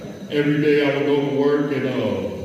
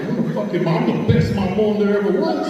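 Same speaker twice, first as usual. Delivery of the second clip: cocky, higher, with a laugh; proud boasting; 7 semitones higher than usual. Retold or imitated. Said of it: "I was all proud".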